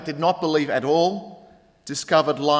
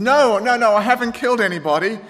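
loud, fast, higher mock protest; 8 semitones higher, 7 dB louder than usual. Pastor denying to the barista, loud and fast. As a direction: insist quickly, half laughing.

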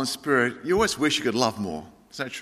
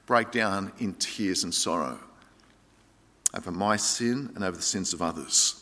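sorrowful; 7 semitones lower, 7 dB quieter than usual. Real, not acted. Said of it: "I've just had to walk away".

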